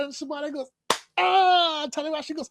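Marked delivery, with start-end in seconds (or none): 1.2-1.8 high, held imitation wrestler yell; high drawn-out "aah"; mock battle cry. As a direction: answer with a high-pitched war cry. Imitating wrestlers roaring in turn.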